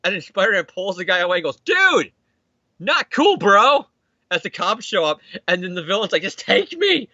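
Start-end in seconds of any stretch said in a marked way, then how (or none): 1.6-3.9 high-pitched, drawn-back scolding; mock appalled. Hero scolds while pulling someone away. 6.2-7.1 high, comic begging; mock desperate plea. Villain begs the cops for arrest.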